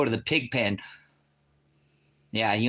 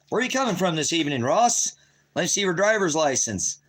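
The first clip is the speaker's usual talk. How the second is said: mock traffic cop; 6 semitones higher than usual. Streamer playing a cop pulling Ross over, pushy mock cop.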